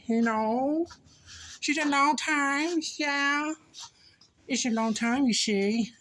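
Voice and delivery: high, lilting put-on voice; playful singsong. Riffing on missing a friend, playful high singsong. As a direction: keep it up high with rising ends.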